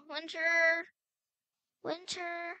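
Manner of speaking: drawn-out searching call; stretched-out name, calling someone lost. Pretend. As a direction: call the name out long, as if into the distance. Calling for missing sister.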